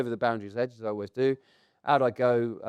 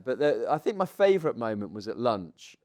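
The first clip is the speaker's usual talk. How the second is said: usual speaking voice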